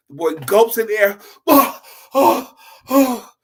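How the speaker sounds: loud high gasps, sucking in air; gasping for air. Acted. Boy surfacing from water, loud desperate gasps. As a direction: gulp the air like you nearly drowned.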